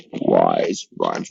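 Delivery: low, slow correction after a squeak; deliberately deep, slow redo. Shown as a demonstration. Teacher redoes a squeaky line low and slow. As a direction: drop the voice low and drag it out. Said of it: "oh, that was squeaky".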